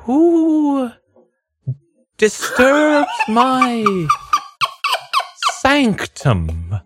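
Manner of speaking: high, slow, eerie ghost. Acted. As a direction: moan it like a spooky ghost. GM voices the ghost.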